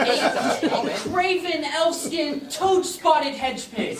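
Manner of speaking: slow, relished mock-Elizabethan abuse; theatrical insult. Playwright starting a tirade at his rival, slow and theatrical. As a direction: draw out every insulting word.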